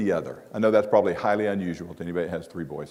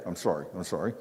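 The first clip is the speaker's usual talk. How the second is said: mumbled, quieter, half-hearted sorry; grudging kids' apology; 4 semitones lower, 7 dB quieter, faster than usual. Retold or imitated. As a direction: toss off the apology like a sulky kid. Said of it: "What'd they say?".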